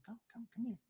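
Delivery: soft, high beckoning call; Takagi beckoning. Acted. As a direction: wave them over in a hushed high voice. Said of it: "He's like".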